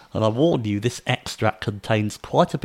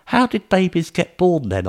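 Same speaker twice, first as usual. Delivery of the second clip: put-on child; 8 semitones higher than usual. A narrator's reading. Child asks.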